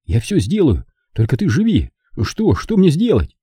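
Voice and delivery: urgent plea climbing in pitch; desperate offer, rising to a high question. Acted. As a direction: beg for any way to help. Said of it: "в отчаянии".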